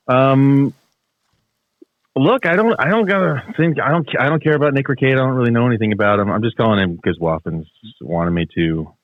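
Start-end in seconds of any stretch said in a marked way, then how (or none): none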